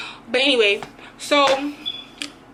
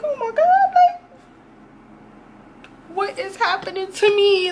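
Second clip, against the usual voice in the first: "Oh my God" squealed much higher, in panic; panicked high squeal; 9 semitones higher than usual. Retold or imitated. Telling her friends how she panicked, in a high frightened squeal. Panicking about being laced.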